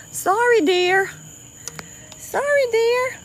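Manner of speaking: high-pitched, loud sorry to an animal; cooing apology. Real, not acted. Apologising to alligator she hit.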